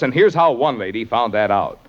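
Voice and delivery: normal talking voice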